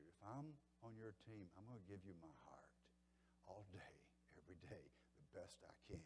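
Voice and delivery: hushed, earnest whisper; a heartfelt whispered vow; whispered pledge. Acted. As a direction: breathe the promise quietly, almost secret. Pledging his heart to teammates.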